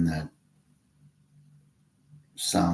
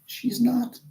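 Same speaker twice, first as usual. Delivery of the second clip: not jumping way up into a squeak; an incredulous squeak up high; emphatic squeak. Acted. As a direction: squeak the repeat in disbelief. Repeated denial, jumps high.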